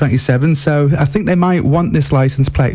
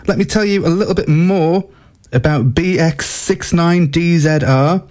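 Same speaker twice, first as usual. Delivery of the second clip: ordinary delivery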